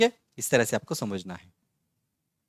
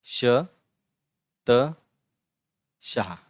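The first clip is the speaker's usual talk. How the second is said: "श", "त", "शाह" each alone, long pauses between; slow syllable breakdown; 3 dB louder, much slower than usual. Shown as a demonstration. Teacher repeating the example for pupils, syllable by syllable.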